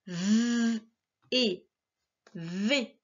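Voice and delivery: "V" as a long buzzed vvv, then "et", then "V" blended as vé; sounding out. Shown as a demonstration. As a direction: break the syllable into held "V", pause, "et", pause, whole "V". Teacher sounds out a syllable.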